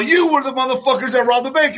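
high-pitched acted accusation; worked-up witness pointing out the culprit; freaking out. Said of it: "you start freaking out".

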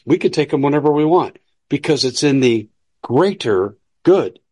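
usual speaking voice

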